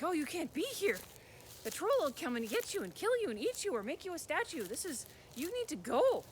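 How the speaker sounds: put-on a frightened servant boy hurrying a stranger away; boy's nervous warning.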